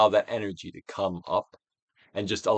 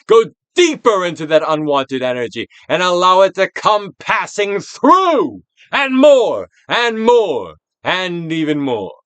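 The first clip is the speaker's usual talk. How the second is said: intense coaxing; 7 semitones higher, 10 dB louder than usual. Acted. Coaching energy release, drawn out.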